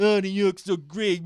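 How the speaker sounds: high, loud mocking voice; sarcastic mimicry of a New York fan; mocking whine. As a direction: parrot her love of New York in a sneering tone. Mocking partner's view.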